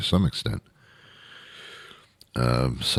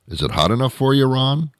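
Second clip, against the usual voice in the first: higher mock friendly question; raised, goofy questioning voice; stupid small-talker; 8 semitones higher than usual. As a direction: imitate a clueless acquaintance making small talk. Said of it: "they actually sound and look stupid".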